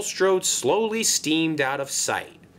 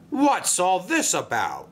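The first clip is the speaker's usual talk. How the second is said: higher, mildly indignant demand; indignant cry; 6 semitones higher than usual. A narrator's reading. Railway boss confronting the crashed barge, mildly indignant. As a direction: demand an explanation, voice lifted. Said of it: "cried Sir Topham Hatt".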